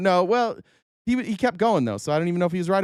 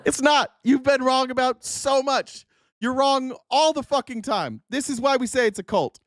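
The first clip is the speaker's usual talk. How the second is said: high, heated retort, drawn out for emphasis; indignant, slow-hammered contradiction; exasperated rebuttal; 9 semitones higher than usual. Real, not acted. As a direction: argue back hotly, pitched well up. Arguing back at played clip.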